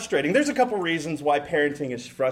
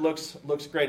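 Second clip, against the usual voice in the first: ordinary talk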